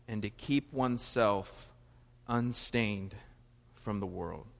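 slow, solemn reading voice; scripture reading. Acted. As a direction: read the verse slowly and gravely. Reads scripture.

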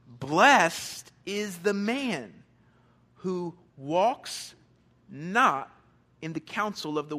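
slow, weighty reading of the verse; scripture reading. Acted. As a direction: lay each line down slowly, with solemn weight. Pastor reads the verse aloud.

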